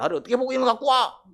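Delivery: high, loud offended outburst; mock yell of an insulted upright man; shouting offence at a bribe; indignant shout. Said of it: "고함을 지르는 사람도 있습니다".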